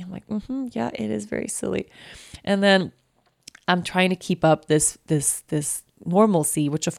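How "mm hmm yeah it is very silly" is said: quiet, high agreeing voice; soft humoring. Retold or imitated. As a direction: gently go along with her. Mom humoring child softly.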